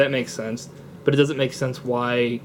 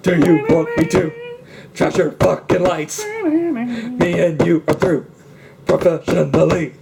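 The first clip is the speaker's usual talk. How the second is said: louder and higher, menacing in a hammed-up way; mock movie bully; 4 semitones higher, 5 dB louder than usual. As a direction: play a blustering tough guy threatening a man. Imitating a bullying movie character.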